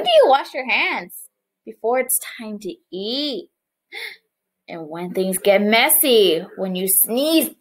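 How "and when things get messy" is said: ordinary talk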